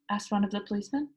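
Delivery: usual speaking voice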